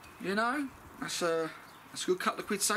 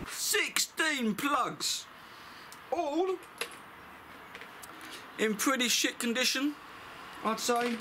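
disgusted plugs; 9 semitones higher, slower than usual. Real, not acted. Inspecting dirty old plugs.

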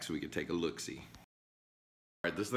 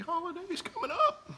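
voice cracking. Real, not acted. Sick host wishing viewers happy holidays, voice breaking. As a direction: voice cracks up high into a laugh. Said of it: "Sorry, my voice".

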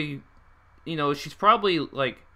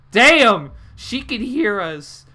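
loud higher cry, then slow; startled yell; 6 semitones higher, 10 dB louder, much slower than usual. Real, not acted. Player cries out realising Monica can hear them. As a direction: blurt it out, then drag the realisation.

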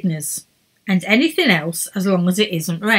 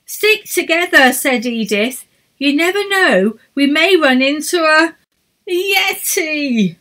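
warning leader; 8 semitones higher, 5 dB louder than usual. A narrator's reading. Edith warns of yeti.

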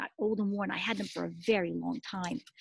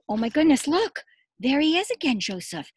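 higher and louder, eager exclaim; excited surprise; 5 semitones higher, 6 dB louder than usual. Real, not acted. Teacher showing kids the next picture, excited. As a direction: marvel at what's on the page. Sees Joseph on new page.

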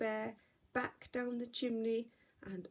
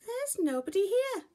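much higher, louder disappointed bear cub; little bear voice; 12 semitones higher, 7 dB louder than usual. Acted. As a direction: sound let down, like a small cub. Baby Bear on the moon.

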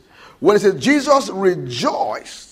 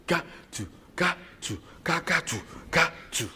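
soft, even mock drum sounds "ka, tu"; controlled drumbeat; 3 semitones lower, 10 dB quieter, faster than usual. Shown as a demonstration. Preacher showing controlled beating, soft drum sounds. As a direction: tap out "ka, tu" quietly in strict rhythm. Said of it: "that's controlled".

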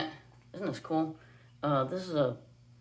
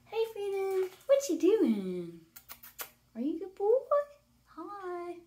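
high-pitched bird talk; 12 semitones higher, slower than usual. Real, not acted. Owner greeting her parrot Freedom in high-pitched coo. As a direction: coo up high like talking to a pet. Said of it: "He does enjoy a high-pitched voice".

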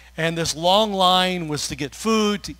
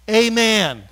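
high, loud call for agreement; preacher's loud amen; rousing amen; 6 semitones higher, 9 dB louder than usual. Real, not acted. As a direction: call out to the room. Preacher calls for response.

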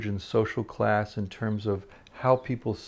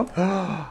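sharp, high gasp of shock; 8 semitones higher than usual. Real, not acted. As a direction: gasp in real dismay. Host shocked by the story.

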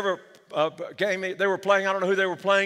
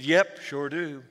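put-on unbothered old man, lower and slow; calm; 6 semitones lower, slower than usual. Old man answers Satan from the front row, lower and calm. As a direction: shrug it off, slow and easy. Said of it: "said calmly".

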